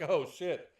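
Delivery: loud, panicky, laughing god voice; flustered Thor impression. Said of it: "He wouldn't have had to just like".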